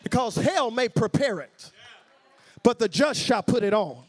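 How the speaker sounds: loud, punched sermon declaration; preaching. Real, not acted. Preacher promising the just shall put it on, forceful preaching. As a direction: proclaim it forcefully to the congregation.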